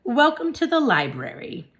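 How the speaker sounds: high, loud welcome from the librarian; exclaiming welcome. A narrator's reading. Librarian welcomes the class hamster, high and loud. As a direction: greet the hamster brightly, pitch up.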